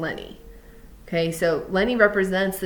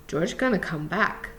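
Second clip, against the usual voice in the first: ordinary delivery, nothing marked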